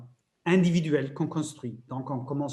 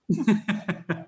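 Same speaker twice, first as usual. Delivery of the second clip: laugh; 3 semitones higher than usual. Real, not acted. Laugh after the stumble.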